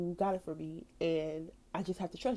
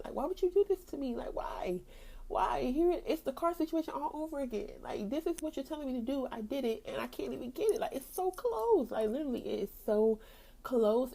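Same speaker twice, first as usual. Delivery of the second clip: exasperated pleading; 7 semitones higher than usual. Retold or imitated. Acted protest to God over housing.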